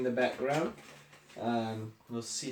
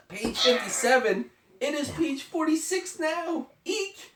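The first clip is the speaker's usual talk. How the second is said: high, rising panic, louder; panicky; 5 dB louder than usual. Acted. Elephant panicking as the book speeds to its end. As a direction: squeal out the page numbers.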